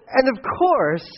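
usual speaking voice

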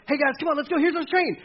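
high, eager call to the group; mock leader rounding up the team; hurried team leader call. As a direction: wave the team onto the train. Leader hurrying team onto train.

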